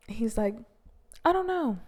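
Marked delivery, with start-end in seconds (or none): 1.2-1.8 high-pitched, imitated "I don't know"; mock noncommittal reply, high; retold noncommittal answer. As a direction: pitch it way up, mimicking a reluctant reply. Retold reluctant answer in argument.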